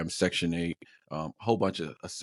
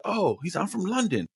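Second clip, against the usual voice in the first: high-pitched imitation of a London accent; put-on London voice, high; 11 semitones higher than usual. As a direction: mimic a Londoner, pitched well up. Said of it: "what's that accent from".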